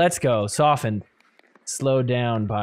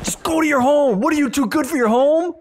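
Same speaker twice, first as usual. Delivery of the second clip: Happy Gilmore quote; 12 semitones higher, faster than usual. Retold or imitated. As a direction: ham up the angry golfer yelling at the ball. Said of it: "reminds me of Happy Gilmore".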